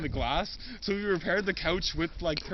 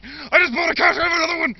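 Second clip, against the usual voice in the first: dad's high, loud outburst; demanding dad yells he bought a couch; shouted quote; 11 semitones higher, 11 dB louder than usual. Retold or imitated. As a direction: blurt it out at the salesman. Dad yelling at salesman.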